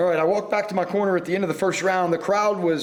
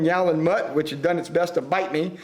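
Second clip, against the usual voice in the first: ordinary talk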